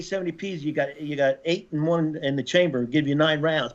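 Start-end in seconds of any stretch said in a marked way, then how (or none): none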